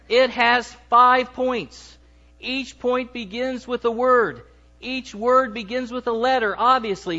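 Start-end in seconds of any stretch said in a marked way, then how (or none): none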